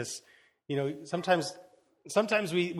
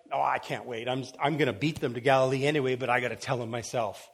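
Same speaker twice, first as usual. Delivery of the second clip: ordinary delivery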